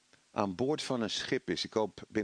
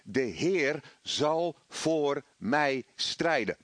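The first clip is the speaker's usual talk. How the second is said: firm declaration; 2 semitones higher, much slower than usual. Acted. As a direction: declare it against the doubts, word by word. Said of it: "Dat je zegt". (langzaam en vastberaden belijden)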